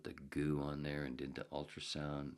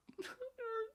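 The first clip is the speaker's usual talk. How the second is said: laugh-cry. Real, not acted. Laugh-cry remembering doctor visit.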